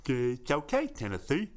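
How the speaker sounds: lisping cartoon walrus voice; put-on walrus character voice; Chumley. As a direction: do a dopey cartoon walrus sidekick. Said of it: "But this was Chumley from Underdog".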